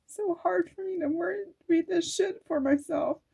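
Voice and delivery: real emotion breaking through the words; choked up. Real, not acted. Reading kind words to herself, voice high and tearful. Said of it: "I get so emotional".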